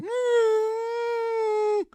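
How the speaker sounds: high, held, strained hum; choked-up hum. Retold or imitated. Telling the host how he broke down, a high, held hum. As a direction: hold a crying hum on one note. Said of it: "And I was like".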